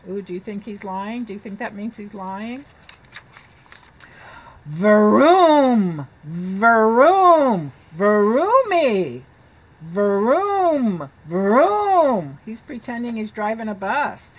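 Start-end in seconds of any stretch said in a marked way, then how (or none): 4.6-12.4 loud revving vroom vroom, each falling; bus engine noises. Acted. Pigeon pretends to drive, making loud engine noises. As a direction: rev the bus engine with your mouth for the kids. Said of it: "pretending and making the noises".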